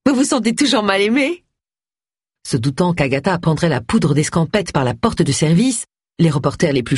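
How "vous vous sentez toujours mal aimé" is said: high, teasing question; high, playful challenge; merry cry. A narrator's reading. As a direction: tease cheerfully, pitched up. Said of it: "s'écria Tony en riant".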